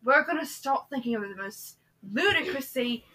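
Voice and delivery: put-on a light British accent. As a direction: slip into British. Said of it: "And now we're British".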